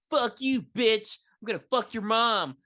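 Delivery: high-pitched mock bully insults; hammed-up bully taunting. Voices the bully's insults again.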